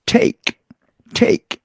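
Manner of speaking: "Take" said as one crisp English syllable; demonstrated pronunciation. Shown as a demonstration. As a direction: say "take" carefully, closing on a k. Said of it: "이렇습니다".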